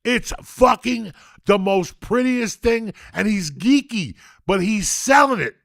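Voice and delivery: high, loud, slow ecstatic shouting; ecstatic rave. Real, not acted. Praising Pee-wee's bar dance to the room, ecstatic shouting. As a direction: rave like it's the greatest scene ever. Raving about favourite comedy scene.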